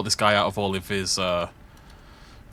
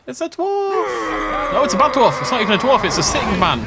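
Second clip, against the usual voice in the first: excited exclamation; 12 semitones higher, 4 dB louder than usual. Real, not acted. Laughing at a bad dwarf.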